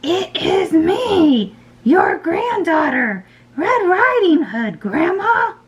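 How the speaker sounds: very high, slow put-on little girl. A narrator's reading. Wolf posing as granddaughter at the door, high girlish voice. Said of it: "in his best little girl voice".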